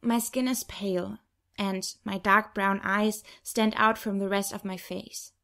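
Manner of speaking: ordinary delivery, nothing marked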